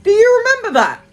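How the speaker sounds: high, loud incredulous question; excited. Real, not acted. Asking viewers, high and loud, if they remember. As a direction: pitch it way up in disbelief. Recalling absurd panic buying.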